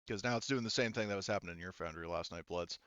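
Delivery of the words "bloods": ordinary talk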